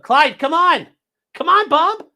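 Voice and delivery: high sing-song dog call; coaxing the dog. Real, not acted. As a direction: plead with the dog in a high coo.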